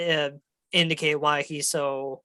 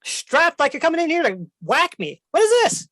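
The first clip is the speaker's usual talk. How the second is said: suspicious dragon; 11 semitones higher than usual. Acted. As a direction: squawk that they came to whack him. Dragon accuses intruders.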